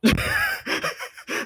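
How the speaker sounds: very high helpless laugh; high laughter. Real, not acted. Player laughing at friend's confusion, high laughter. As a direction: squeal with real laughter.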